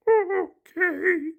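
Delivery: high monster voice, grudging okay; high puppet-voiced grudging okay; reluctant Grover. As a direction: give in though you don't want to.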